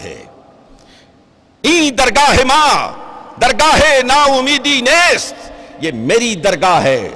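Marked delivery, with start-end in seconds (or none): 1.6-2.9 high, forceful verse; raised Persian summons; ringing proclamation. Acted. Divine summons repeated in proclamation. 3.4-5.3 high, ringing verse ending; full-voiced Persian declamation; forceful proclamation.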